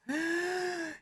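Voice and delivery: sharp high gasp; shocked gasp. Acted. Jury reacts in the script.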